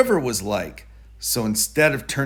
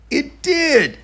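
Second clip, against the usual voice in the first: sudden high, loud burst of joy; delighted surprise; 13 semitones higher, 4 dB louder than usual. A narrator's reading. Narrator cheering as George's paper boat floats, delighted.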